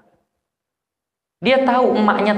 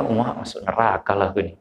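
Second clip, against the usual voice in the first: mock fearful sigh; 8 semitones lower, 5 dB quieter than usual. Imitating fearful sinner.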